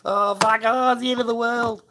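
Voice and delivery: mock a weeping man falling apart; mock crying man. Mocking a crying reality TV contestant, in a hammed-up high whine. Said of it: "he's standing there fucking crying".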